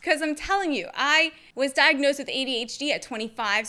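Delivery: ordinary delivery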